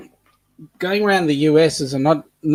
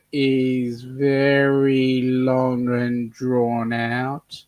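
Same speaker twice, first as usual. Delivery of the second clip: drawn out; 2 semitones lower, 5 dB quieter, much slower than usual. Shown as a demonstration. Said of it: "a Queensland accent is".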